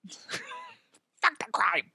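very high, soft squeak; tiny squeal. Acted. Repeating the robot driver's defiant line, in a tiny squeal. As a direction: squeeze it out tiny, high and slow.

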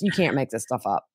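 ordinary delivery

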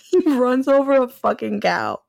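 high-pitched, laughing through the words. Real, not acted. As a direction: tell it while cracking up. Laughing while telling the punchline.

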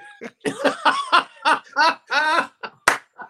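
long, high, loud laugh; helpless laughter. Real, not acted. Cohost cracking up picturing Ben talking, laughing hard. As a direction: burst out cracking up. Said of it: "why are you laughing".

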